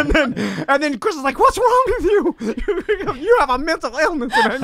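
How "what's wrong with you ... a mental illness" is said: high-pitched imitation of an appalled wife; mock scolding. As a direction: squeal the reproach, breaking into laughter. Retelling wife's alarmed scolding.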